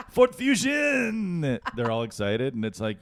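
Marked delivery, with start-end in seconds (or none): 0.1-1.4 high, drawn-out, shouted product name; mock ad shout. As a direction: yell it like a thrilled car commercial.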